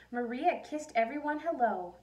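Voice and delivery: ordinary delivery, nothing marked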